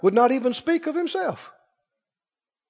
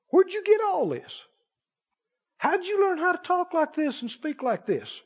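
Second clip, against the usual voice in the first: soft, high-pitched marvelling questions; amazed questioning; 9 semitones higher, 5 dB quieter than usual. Acted. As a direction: ask as an awed, baffled scholar. Scholars questioning Jesus personally, acted out.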